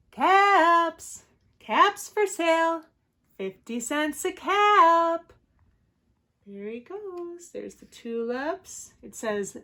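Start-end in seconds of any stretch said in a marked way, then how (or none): 0.1-5.3 high, loud, drawn-out sales cry; street vendor's call. A narrator's reading. Peddler walking back to town, calling his wares. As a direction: call out your wares, stretching each phrase. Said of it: "he walked back to town calling".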